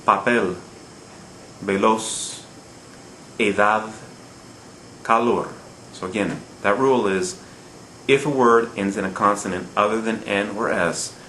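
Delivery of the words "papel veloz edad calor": paPEL, veLOZ, eDAD, caLOR; final-syllable stress. Shown as a demonstration. Teacher letting learners hear the stress, Papel to calor each stressed on the last syllable. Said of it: "Hear the stress on the final syllable".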